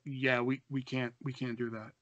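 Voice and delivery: soft, low, halting letdown; sheepish refusal. Retold or imitated. Buddy refusing after long phone pause, sheepish. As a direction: trail away quietly as the reluctant buddy.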